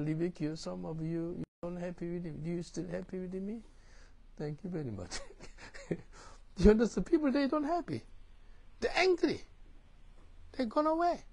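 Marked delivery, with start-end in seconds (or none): 5.9-11.2 emphatic preaching. Real, not acted.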